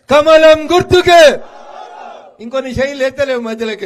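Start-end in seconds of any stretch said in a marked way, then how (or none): 0.1-1.4 slow, very loud rally chant; loud slogan. Real, not acted. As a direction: belt out the slogan with everything. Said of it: "జర గట్టిగా చెప్పుర్రి".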